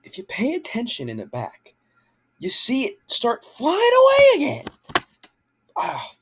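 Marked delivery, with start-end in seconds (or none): 2.4-4.6 indignant disbelief. Real, not acted. Outraged at the car flying away.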